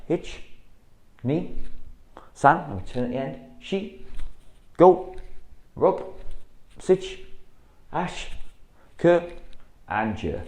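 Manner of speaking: loud, high drill count; sharp dojo counting; called count. Shown as a demonstration. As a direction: bark each number to the class. Coach calls out drill count.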